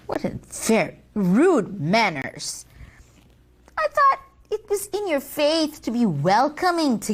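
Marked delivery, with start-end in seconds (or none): none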